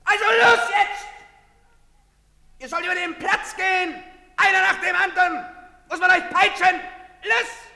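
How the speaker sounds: loud commanding shouts; barked orders. Acted. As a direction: drive the crowd across the square, shouting. Soldier herding people across square.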